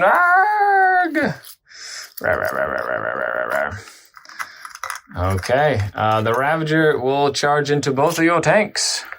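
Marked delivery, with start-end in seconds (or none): 0.0-1.2 high drawn-out playful yell; a sudden high "aargh" for the charging model; mock battle cry. Miniature charges into the squad, mock battle cry. Voicing Thisk making the charge. 2.2-5.3 low, repeated "rah rah" growl; pretend monster growling. Player voices his charging miniature, pretend monster growling. Playing out Thisk's attack.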